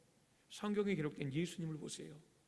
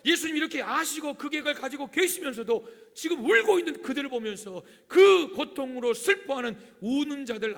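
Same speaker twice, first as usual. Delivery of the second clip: loud, high, impassioned sermon delivery; preaching; 8 semitones higher, 15 dB louder than usual. Real, not acted. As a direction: drive the point home at full voice. Preaches at full force. (목청 높여 열변하는 설교)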